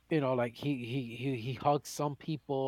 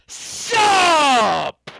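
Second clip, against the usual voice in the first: drawn-out shouted sup; 11 semitones higher, 12 dB louder than usual. Acted. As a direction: tease the line, then belt out "sup?". The host's signature "sup" bit.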